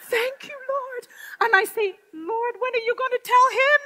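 high, bright laughing praise; joyful thanks. Retold or imitated. Woman laughing her thanks to the Lord. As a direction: let the joy spill over with a laugh.

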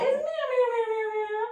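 string of high-pitched meows; cat meows. Pretend. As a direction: meow quickly like a playful cat. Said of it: "Make a cat noise".

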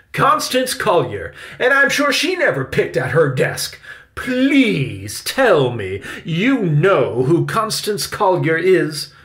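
high, loud, drawn-out indignation; scolding attack. A narrator's reading. Victoria attacks Benny over Constance Collier, indignant scolding. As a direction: stretch key words in haughty disbelief. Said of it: "Victoria continued her attack".

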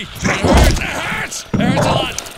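loud, high game-injury wailing; mock pained yell. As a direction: yell as if the game hit hurt.

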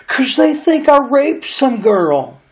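slow, high, frightened imitation; scared high whine. Accused man explaining himself to the narrator, mock scared whine. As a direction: drag it out in a panicked high whine. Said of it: "out of breath all of a sudden and scared".

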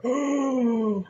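high, drawn-out mock "oh"; imitated offended gasp. Woman reacting to being told off, imitated offended gasp.

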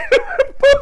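short high shrieking laugh; real high-pitched cackle. Real, not acted. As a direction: keep laughing hard. Laugh continues.

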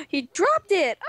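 high, slow gasp of delight; surprised gasp. Real, not acted. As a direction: gasp at the loot. Gasp at the loot.

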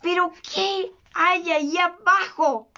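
understated captain's fury; mild rage. A narrator's reading. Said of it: "Su cara se puso verde de rabia".

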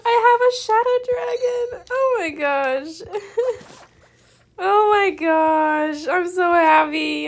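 high, loud, slow drawn-out joy; overjoyed loud squeal; excited shout. Real, not acted. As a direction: shout with delight. Gets another shadow dragon.

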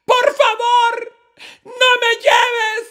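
very high, loud wailing plea; súplica desesperada. Acted. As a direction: beg God at the top of your voice in a high, shouted cry. Acted plea of dying woman to God.

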